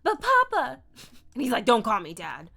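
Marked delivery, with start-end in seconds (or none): 0.0-0.8 high, loud Southern wail; over-the-top high pleading; uncool Southern protest. Acted. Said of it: "being not cool". 1.6-2.5 loud, gruff father; disapproving papa's loud scolding; stern rebuke. Acted. Papa rebukes Sabine.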